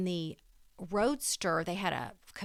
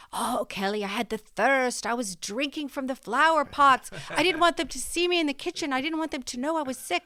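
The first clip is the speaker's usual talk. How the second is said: put-on higher, lilting Irish brogue of an old grandmother; grandmother's Irish accent; 5 semitones higher, 5 dB louder than usual. Granddaughter imitating her dramatic Irish grandmother's brogue. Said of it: "that's a great accent".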